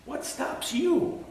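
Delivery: a high, slow searching repeat; high pleading question. Acted.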